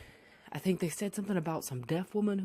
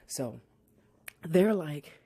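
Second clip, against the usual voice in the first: ordinary delivery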